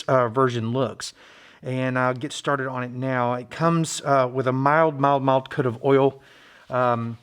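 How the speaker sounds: normal talking voice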